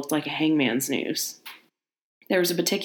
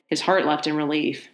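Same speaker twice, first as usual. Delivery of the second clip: ordinary delivery, nothing marked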